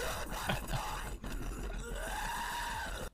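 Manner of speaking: a breathless high laugh; airy burst of laughter. Real, not acted. Laughs at Speedy comparison.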